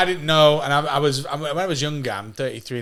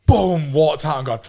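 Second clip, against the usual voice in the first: ordinary delivery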